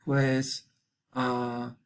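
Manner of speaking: ordinary talk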